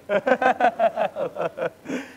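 loud, high burst of laughter; laughing. Real, not acted. Preacher laughing at his phone's interruption, real laughter. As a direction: laugh out loud, helplessly amused.

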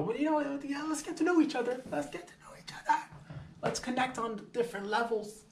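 very high, squeaky falsetto quote; mock sweet-talk. Man coaxing his date to connect, mock falsetto. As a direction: put on a silly falsetto to coax.

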